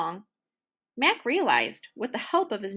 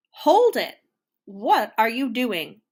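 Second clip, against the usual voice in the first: conductor calling halt; 2 semitones higher, 7 dB louder than usual. A narrator's reading. Conductor stops the orchestra, loud sharp halt. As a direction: cut the music off with a shout. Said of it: "asked the conductor".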